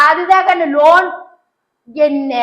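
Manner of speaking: ordinary delivery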